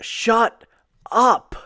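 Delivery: high, loud snap of shut up; mock angry shout. [name] silencing Ali in the ring, loud mock snap. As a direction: bark it down at the assistant.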